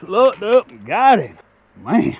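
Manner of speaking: loud, high, slowed with real thrill; excited. Real, not acted. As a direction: call it out as the fish jumps. Fish jumps during the fight.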